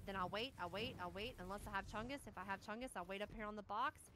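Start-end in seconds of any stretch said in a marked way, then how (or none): none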